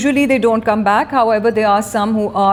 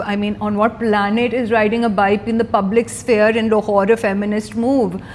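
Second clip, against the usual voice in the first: normal talking voice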